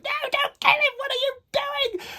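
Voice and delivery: very high, loud panicked shout; screaming. Retold or imitated. A reader reliving her scream at the book, high panicked shout. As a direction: shriek at the villain to stop. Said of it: "genuinely screaming out loud".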